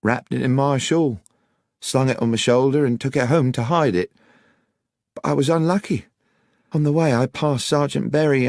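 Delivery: put-on high-pitched young countrywoman telling her misfortune; Miss Miller, rueful account. Voices Miss Miller in story.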